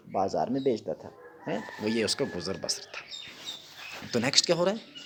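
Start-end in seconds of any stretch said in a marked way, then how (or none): none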